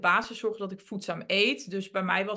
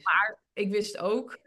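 usual speaking voice

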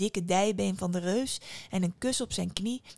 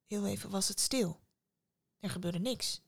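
plain reading voice

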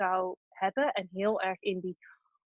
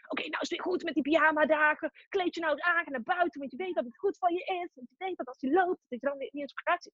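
higher, quick nagging at oneself; nagging inner voice; 6 semitones higher, faster than usual. Acted. Inner voice scolding her for pyjama days, higher and pushy. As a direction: play the little voice chivvying you along. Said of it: "kwam dat stemmetje van".

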